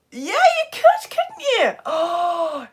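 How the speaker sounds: very high, loud squeal of agreement; high-pitched delighted agreement; amused exclamation. Real, not acted. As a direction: squeak it out, tickled by the idea. Amused reaction to baby suggestion.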